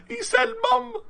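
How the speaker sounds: high laughing squeal of delight. Real, not acted. Streamer squealing with laughter that a character said mum.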